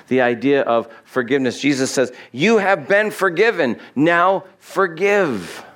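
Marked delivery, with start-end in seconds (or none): 2.3-5.6 higher and very slow on now forgive; emphatic command. Acted. Jesus urging the forgiven to forgive, slow and emphatic. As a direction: press each word home slowly.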